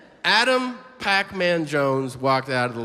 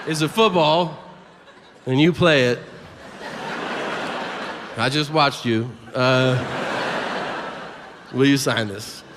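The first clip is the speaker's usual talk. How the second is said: over-clear explaining; 2 semitones lower than usual. Retold or imitated. Friend makes his request more clear, deliberate imitation. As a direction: spell it out in separate bits, as the friend. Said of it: "decides to make it more clear".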